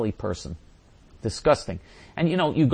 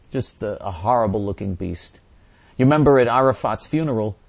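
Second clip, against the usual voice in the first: ordinary talk, nothing marked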